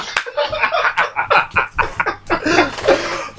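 airy wheezing laughter; long breathless laugh. Real, not acted. Continued laughter.

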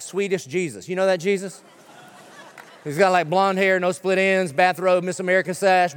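usual speaking voice

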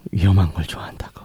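husky close whisper. Acted. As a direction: breathe it into her ear. Leaning in close to partner.

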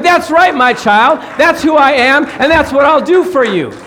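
God booming back with joy; excited voice of God. Acted. Getting excited now here about this.

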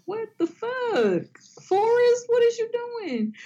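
high, incredulous call-out; mock outrage. Calling out Forrest Whitaker, high and incredulous. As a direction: pitch it up in disbelief.

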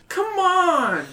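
put-on high pleading boy voice. A narrator's reading. Tied-up Jake pleads with the kids, high and whiny. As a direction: beg them to hurry up. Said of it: "Jake pleads".

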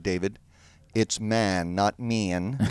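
"man" said with a flat clean a, not "mean", quiet and low; teacher correcting vowels. Retold or imitated. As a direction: drama teacher drilling "man" against the Baltimore "mean". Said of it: "drama teacher who said".

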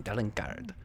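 low, soft Spanish-tinged character voice; imitated suave name. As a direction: murmur the name low, with an accent. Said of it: "That's how he says his name".

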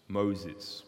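God calling out low and soft from the burning bush; a deep, quiet call of the name. Acted. God calls Moses from the bush, low and soft.

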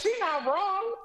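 laughing agreement. Real, not acted.

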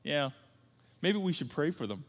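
soft, calm suggestion; a hint of a gentle wife; wife's gentle reply. Wife calmly suggests praying, soft and calm. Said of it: "Donna will point that out, my wife. She'll say".

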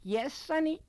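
cracked voice straining to sound kindly; broken, falsely warm voice. A narrator's reading. Lady answering the boy at her door, trying to be warm. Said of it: "one of those broken voices that tries to be warm".

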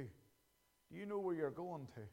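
low, soft and slow; hushed. Acted. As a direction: let the question fall quietly. Quiet repeated question.

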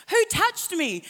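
sudden high, loud demand; Jesus asking. Retold or imitated. Jesus demanding who touched him, high and loud. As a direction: call out, searching the crowd. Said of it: "Jesus says".

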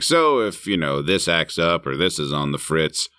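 a hint of a spooky narrator dad; movie dad voiceover. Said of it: "the dad doing the voiceovers".

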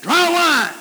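"Dry wine" thrown out high and loud in disbelief; incredulous. Shown as a demonstration. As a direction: say "Dry wine" as if it were absurd. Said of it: "No, wait a minute".

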